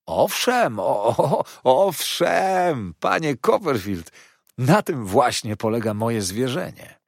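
high, loud, excited insistence; fawning Heep. Acted. Heep insisting there is cause for thanks, gushing. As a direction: protest eagerly and servilely.

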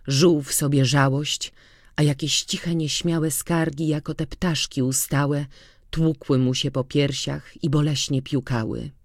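ordinary delivery, nothing marked

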